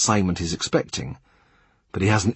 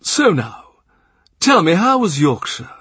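higher, sweetly coaxing Cardinal; honeyed tones; 8 semitones higher, 4 dB louder than usual. A narrator's reading. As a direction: coax the story out of him. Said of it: "in those honeyed tones famous from here to Vienna".

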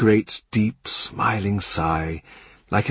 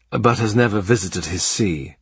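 ordinary delivery, nothing marked

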